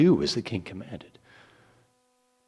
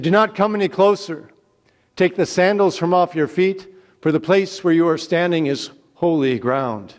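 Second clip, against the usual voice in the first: loud, high command; commanding divine voice; 7 semitones higher, 8 dB louder than usual. A narrator's reading. God warning Moses off holy ground, loud commanding voice. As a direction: boom the warning down from above.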